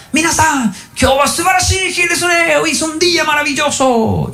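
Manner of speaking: imitación aguda y eufórica de un maestro japonés gritando; saludo gritado. Retold or imitated. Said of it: "cuando entraba al salón gritaba". (grito agudo de maestro exaltado)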